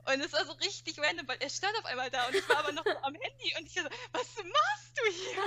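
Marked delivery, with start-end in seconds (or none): 4.1-5.4 high, slow, baffled question; stunned, drawn-out surprise; astonished. Retold or imitated. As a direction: drag out the shock of seeing him. Boyfriend suddenly appears.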